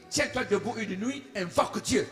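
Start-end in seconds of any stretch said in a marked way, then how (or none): none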